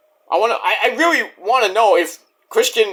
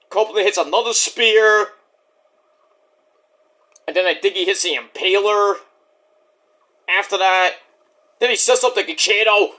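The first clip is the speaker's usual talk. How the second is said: ordinary delivery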